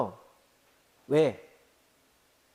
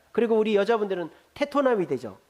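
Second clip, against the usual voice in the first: ordinary talk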